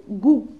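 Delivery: "goût" said loud as one syllable, hard G; hard G plus OU. Shown as a demonstration. Teacher contrasting it with go for pupils, emphatic "goût". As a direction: punch out "goût" with an OU vowel. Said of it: "la lettre G avec ou".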